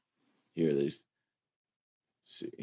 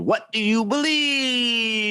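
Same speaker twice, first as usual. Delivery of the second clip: much higher, slow, shouted "What do you believe?" with a cry in it; signature shouted intro; 13 semitones higher, slower than usual. Shown as a demonstration. Host recreating the guest's famous show intro, shouted. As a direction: belt "What do you believe?" like a preacher's catchphrase. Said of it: "He had a really good intro".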